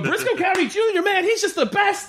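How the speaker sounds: put-on very high, hero-worshipping child voice; gushing little kid. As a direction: imitate a kid raving about a hero. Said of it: "And the kid's like".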